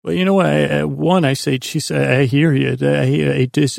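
ordinary delivery

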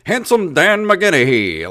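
high, loud, hammed-up old-time prizefighter; old-time boxer voice. Heckler squaring up like a thirties boxer, hammed-up old-time boxer voice. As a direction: bellow it like a thirties ring announcer. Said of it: "like a thirties style pugilist".

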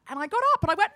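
ordinary talk

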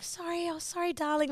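awkwardly sweet mock apology; trying to be nice. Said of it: "trying to be nice".

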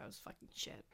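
hushed, voiceless confession; under-the-breath verdict; whispered aside. Real, not acted. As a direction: whisper it like a guilty secret. Confiding a bad club night.

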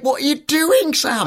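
high, stretched-out mock dismay; slow-motion protest. Viewer trying to stop Sam, slow-motion protest. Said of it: "in slow motion, just trying to say".

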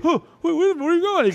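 high mock puzzled man calling out; a lightly hammed confused stranger; confused creep. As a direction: voice him baffled and high-pitched. Voicing the flasher, confused.

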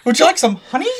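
high cartoon bear voice; Winnie the Pooh. Game master retries the honey-loving bear for laughing players, high voice. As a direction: offer it in a high-pitched bear voice. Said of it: "I cannot do".

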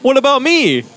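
high put-on voice asking for attention; acted plaintive plea. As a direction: pipe up as the one left out. Acting out someone feeling left out.

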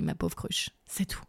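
whispered aside; breathy whispered put-down; whispering. Real, not acted. Mocking aside to herself.

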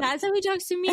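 high-pitched mock protest. Amused protest about husband's soothing talk.

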